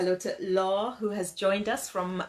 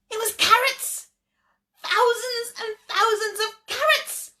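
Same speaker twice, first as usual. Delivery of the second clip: much higher and louder, full of wonder; amazed reveal; 12 semitones higher, 7 dB louder than usual. A narrator's reading. The storyteller reveals to the children that it's carrots, amazed. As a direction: burst out with the surprise.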